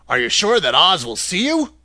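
a lightly voiced injured farmer asking; curious man. A narrator's reading. Man doubts.

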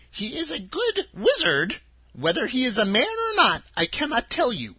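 put-on a sweet little old woman, high and gentle; old witch of the North. Witch of the North telling Dorothy her home, high and kindly.

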